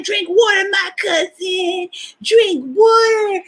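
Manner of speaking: drawn-out high call to drink water; cousin Sonia nagging. Retold or imitated. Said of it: "she's like".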